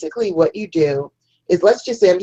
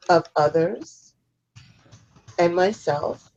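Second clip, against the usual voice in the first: slow phrases with long pauses, lifting at the ends; guided repeat-after-me affirmation; slower than usual. Shown as a demonstration. Host leading listeners to unhook cords, slow guided affirmation. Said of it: "you can repeat after me".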